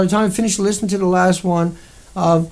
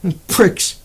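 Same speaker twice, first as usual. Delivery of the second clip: normal talking voice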